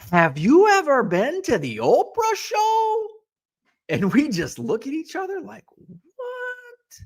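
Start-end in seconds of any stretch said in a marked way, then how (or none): 0.1-3.1 high, slow Fargo drawl on the Oprah question; high, slow North Dakota accent asking the Oprah question. Retold or imitated. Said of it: "the accent was like". 5.7-7.0 baffled what. Retold or imitated. Stunned reaction to odd question.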